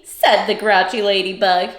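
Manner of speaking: plain reading voice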